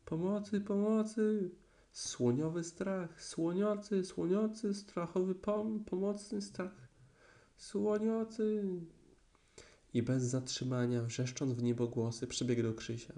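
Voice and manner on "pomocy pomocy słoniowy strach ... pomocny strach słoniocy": very high, squealing garbled cries; panicked shrieking. Acted. Piglet runs from the elephant shrieking for help, high panic. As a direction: shriek in terror, tripping over the words. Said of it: "wciąż krzycząc na całe gardło".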